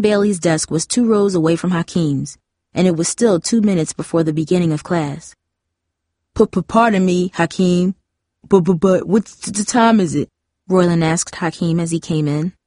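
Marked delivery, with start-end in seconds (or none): 6.3-10.3 exaggerated stammer on first sounds; mock stammering, repeating consonants; mocking stutter. A narrator's reading. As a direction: imitate someone's stutter to tease. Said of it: "he stutters like a baby".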